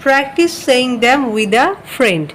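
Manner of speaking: slow, deliberate instruction reading; slow read-aloud. Shown as a demonstration. Teacher reading the task aloud to students, slowly. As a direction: read it out word by word. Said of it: "practice মানে হচ্ছে".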